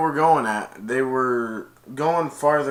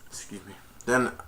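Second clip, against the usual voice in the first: normal talking voice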